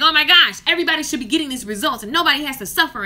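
loud, fast, fired-up outburst; hammed-up burst of passion; fired-up rant. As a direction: rant like everyone deserves results.

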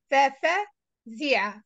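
"ففزع" slowly, pausing before the last part; teacher's slow, split "ففزع"; syllable by syllable. Shown as a demonstration. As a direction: break "ففزع" into fa-fa, zi-a. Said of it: "read it alphabet by alphabet".

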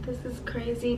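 ordinary talk

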